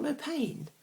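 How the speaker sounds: high-pitched disbelieving echo; incredulous. Acted. Man astonished at his date's answer, incredulous. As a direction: throw it back in high disbelief.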